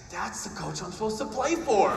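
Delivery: hammed-up anguish, pitched high; mock tearful wail. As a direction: wail it like an overwrought kid. Retelling tearful outburst to his dad.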